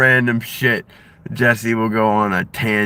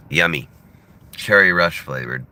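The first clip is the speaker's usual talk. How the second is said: normal talking voice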